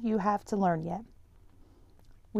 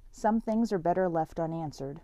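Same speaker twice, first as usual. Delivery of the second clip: usual speaking voice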